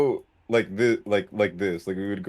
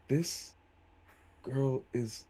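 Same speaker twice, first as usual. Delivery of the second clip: smiling to oneself; 3 semitones higher, 8 dB quieter, slower than usual. Acted. Player as a character secretly smiling while unseen, hushed delight. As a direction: savour it softly, word by word. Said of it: "one of those smiles where it's like".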